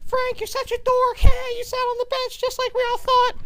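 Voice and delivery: put-on high falsetto teasing girls; mocking ladies. Ladies mocking Frank, high falsetto teasing. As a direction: sneer it in a squeaky girl voice. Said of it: "All the ladies were like".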